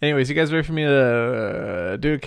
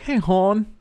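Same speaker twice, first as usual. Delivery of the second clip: higher Baltimore accent with a rounded "hon"; raised Baltimore greeting; 6 semitones higher than usual. Shown as a demonstration. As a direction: stretch "hon" toward "hawn" in Baltimore style. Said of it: "But it's like".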